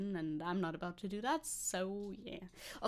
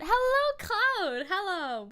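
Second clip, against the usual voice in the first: high, loud sing-out hello; excited greeting; 10 semitones higher, 7 dB louder, much slower than usual. Real, not acted. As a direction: call out a big hello to a newcomer. Greeting someone arriving in chat.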